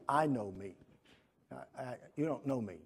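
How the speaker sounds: usual speaking voice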